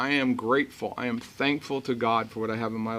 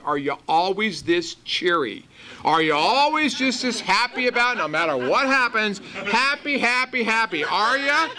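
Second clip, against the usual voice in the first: high, mocking sneer of a cranky old woman; cantankerous old woman; 7 semitones higher than usual. Mimicking the cranky woman on the plane, high and sneering. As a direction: needle him like a bitter old lady. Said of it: "cantankerous and miserable".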